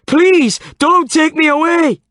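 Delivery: very high, loud panicked wail. A narrator's reading. Old man panicking at the police, wailing. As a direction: wail in terror. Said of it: "wailed the old man".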